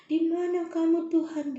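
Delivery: high, loud cry to God; retold desperate cry. Alone on the pier crying out to God, high and desperate. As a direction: call out to God in despair. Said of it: "aku teriak-teriak kayak gitu".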